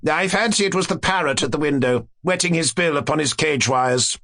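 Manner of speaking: high-pitched, speculative courtier; observing courtier. Acted. Courtier blaming the parrot, helpful. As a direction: float the theory lightly to the king.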